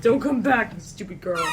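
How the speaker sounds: cold, sneering stepmother voice; wicked stepmother. Acted. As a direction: shoo her off like a cruel stepmother. Sudden mean villain line.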